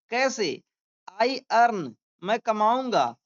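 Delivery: usual speaking voice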